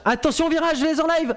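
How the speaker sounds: voix qui monte d'un coup, tendue; alerte lancée. Real, not acted. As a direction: crier l'alerte du commentateur en direct. Crie une alerte au virage. (alerte criée, montée dans l'aigu)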